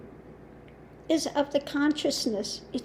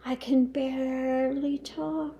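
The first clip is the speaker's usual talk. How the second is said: mock feeble guru; 5 dB quieter than usual. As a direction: act too blissed-out to talk, stretching the words. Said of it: "you're going into this".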